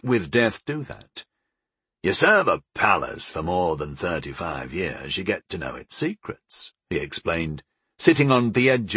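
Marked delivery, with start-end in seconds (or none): 2.0-6.7 low, lightly voiced older dad; confiding explanation, dropping softer; knowing father. A narrator's reading.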